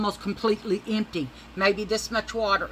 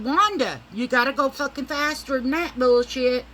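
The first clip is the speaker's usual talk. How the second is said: loud nagging; 8 semitones higher, 8 dB louder than usual. Retold or imitated. High, yelling at slow driver.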